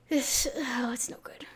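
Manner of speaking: despairing whisper, barely voiced. Real, not acted. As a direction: breathe it out in defeat.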